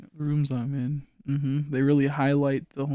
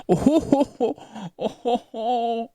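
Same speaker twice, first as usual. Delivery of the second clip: mock villain laugh; 9 semitones higher, much slower than usual. Mock laugh at own joke.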